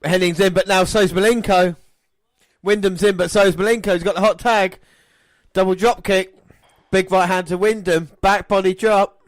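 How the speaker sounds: fast, rising commentator call; excited play-by-play. Acted. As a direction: call the action live.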